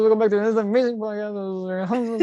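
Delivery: big energetic show opener; hyped podcast intro. Acted.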